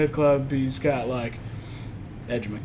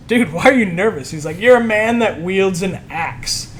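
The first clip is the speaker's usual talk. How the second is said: louder, higher retold pep talk; hyped disbelief; 4 semitones higher, 9 dB louder than usual. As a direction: imitate someone hyping up a nervous fan. Retells Kevin's mock disbelief at nerves.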